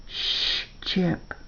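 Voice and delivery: soft, half-whispered shush to a companion; hushing. A narrator's reading. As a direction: hush him gently and quietly. Hushing the dog in story.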